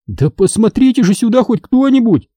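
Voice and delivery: high indignant outburst. A narrator's reading. Boy indignant his parents ignore him, high outburst. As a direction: demand attention, fed up. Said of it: "возмутился я".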